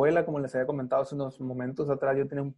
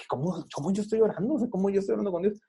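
fast anxious inner voice; 5 semitones higher, 3 dB quieter, much faster than usual. Retold or imitated. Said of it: "Porque dice".

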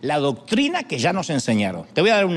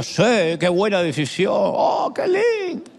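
fake gushing approval; 2 semitones higher, slower than usual. Friend telling you what you want to hear, fake gushing. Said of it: "Lo que querés que diga".

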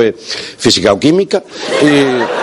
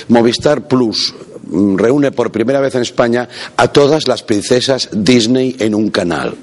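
usual speaking voice

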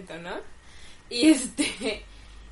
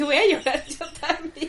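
laughing quote; 4 semitones higher, 3 dB louder than usual. Retold or imitated. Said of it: "Yo dije".

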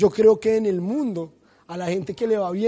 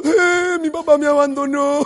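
high, loud, hammed-up whimper; mock sob to the congregation; mock crying; 6 semitones higher, 6 dB louder, slower than usual. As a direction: whine it like a self-pitying child.

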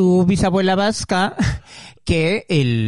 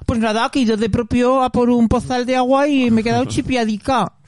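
mock Aragonese accent, higher pitched; broad Aragonese accent, higher and playful; 6 semitones higher than usual. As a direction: put on a thick Zaragoza accent as a Romanian woman would. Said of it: "tienen acento maño".

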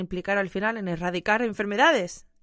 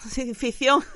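nervous laugh; 5 semitones higher, 4 dB louder, slower than usual. Real, not acted. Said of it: "me río de los nervios".

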